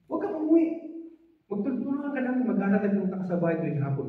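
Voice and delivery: high, urgent pleading friend; high, fast urgent friend; urgent plea. Retold or imitated. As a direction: beg him not to go home. Classmate urges him.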